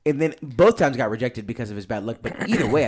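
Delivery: ordinary talk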